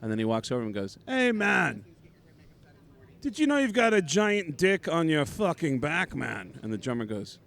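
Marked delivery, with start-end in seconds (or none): 1.0-6.6 higher, mock rock-singer voice for [name]; imitating [name]. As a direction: play the rock singer pointing out the tattoo. Voicing [name] in story.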